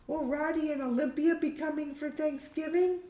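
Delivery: put-on high, girlish character voice; Hamish, young daughter. Daughter figure asks her mother.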